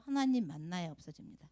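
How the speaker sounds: ordinary delivery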